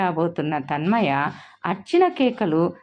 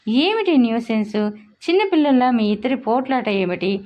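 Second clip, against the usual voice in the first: irritated; 6 semitones higher than usual. A narrator's reading. Husband fed up with the quarreling at home, irritated outburst. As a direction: snap at the whole household in frustration. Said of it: "చిరాగ్గా అన్నాడు".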